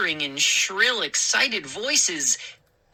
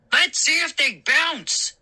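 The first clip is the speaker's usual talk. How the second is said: calling gnome; 10 semitones higher than usual. A narrator's reading. A gnome calls from the back of the room, high gleeful shout. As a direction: call out from the back. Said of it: "a gnome called".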